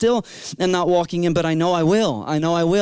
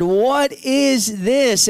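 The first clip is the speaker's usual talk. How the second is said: very slow, higher, overwhelmed; teen asks through tears, very slowly; stunned question; 5 semitones higher, much slower than usual. Retold or imitated. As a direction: draw it out in awe.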